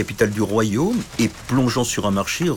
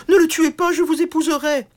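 high, loud desperate plea; pleading princess; 4 dB louder than usual. Acted. Princess pleading with the knight, high and desperate. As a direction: give in to save him.

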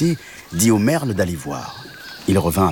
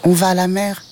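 high, piping kitten; much higher little-animal voice; small kitten voice; 11 semitones higher than usual. Acted. Kitten explains to the mantis, high voice. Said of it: "répondit le chat".

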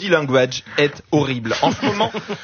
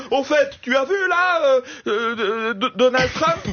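higher, hammed-up stammering chatter; mock passenger chatter; 7 semitones higher than usual. Comic mimicking a passenger's public chat, higher stammering. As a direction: stumble through small talk, hammed up. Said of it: "des conversations publiques comme".

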